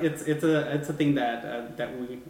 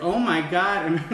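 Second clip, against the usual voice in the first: retold scream, higher and louder, with a laugh; laughing mock scream; 6 semitones higher, 7 dB louder, slower than usual. Woman retelling waking up screaming, laughing. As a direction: laugh through the fright. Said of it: "I was like".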